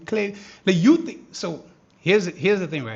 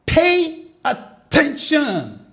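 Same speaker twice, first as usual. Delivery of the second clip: excited call; 6 semitones higher, 9 dB louder, much slower than usual. Real, not acted. Trader calling viewers to watch the chart, excited. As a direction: Pay attention drawn out slowly, higher and much louder. Trader hyped at live price.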